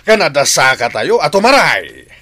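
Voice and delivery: loud laugh through the words; laughing boast. Acted. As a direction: brag and burst out laughing. Boasting about the fight, laughing.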